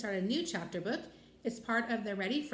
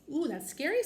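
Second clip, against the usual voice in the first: higher, a touch of fright; mock-scared little girl; 7 semitones higher, 4 dB louder than usual. A narrator's reading. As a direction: play a mildly spooked child. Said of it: "pretending to shiver".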